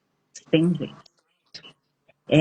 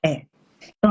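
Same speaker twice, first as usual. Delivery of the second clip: ordinary delivery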